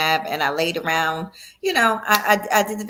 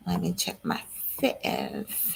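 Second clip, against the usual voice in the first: whispered; 13 semitones lower, 8 dB quieter, slower than usual. Real, not acted. Whispered repeat while checking phone.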